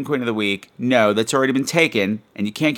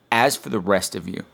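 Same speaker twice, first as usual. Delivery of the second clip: normal talking voice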